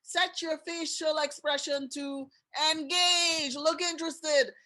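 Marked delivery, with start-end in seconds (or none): none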